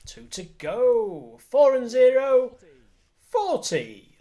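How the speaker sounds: loud, high bingo number call; bingo caller. Acted. Bingo calling on stream.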